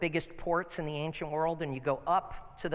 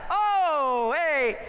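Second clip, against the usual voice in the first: high, loud surprised imitation of an old friend; surprised greeting; 11 semitones higher, 8 dB louder than usual. Pastor imitating the man's surprised hello when he recognizes him. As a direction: yelp a startled hello, much higher and louder.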